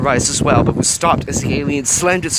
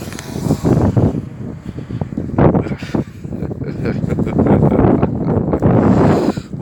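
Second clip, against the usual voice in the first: menacing alien chuckle. A narrator's reading. The Gorg chuckling at the stunned humans, sinister. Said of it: "an amused chuckle".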